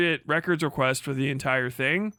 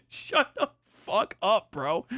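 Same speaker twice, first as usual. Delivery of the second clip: laughing retort; slower than usual. Real, not acted.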